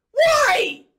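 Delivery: a sudden loud, high exasperated shout; shouting why. Real, not acted. Fan shouting at the coach in disbelief, loud exasperated why. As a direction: blast the question at the coach.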